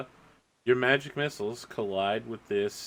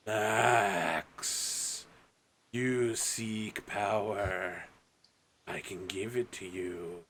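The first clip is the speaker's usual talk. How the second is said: slow, eerie whispering tempter that swells loud at the end; tempting dark voice; 5 dB louder, slower than usual. Acted. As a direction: lure him in like a sinister spirit. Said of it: "you hear a voice that's like".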